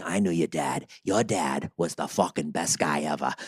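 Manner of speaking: low, soft mock voice of dad's friends; imitating dad's friends. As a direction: mimic an admiring old buddy. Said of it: "Like that".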